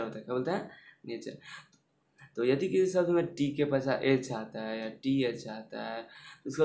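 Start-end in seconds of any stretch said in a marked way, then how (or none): none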